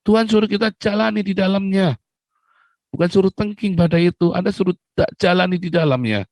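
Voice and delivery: preacher rebuking the church; fiery preaching. Acted. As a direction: drive the point home to the congregation. Preaching at full force.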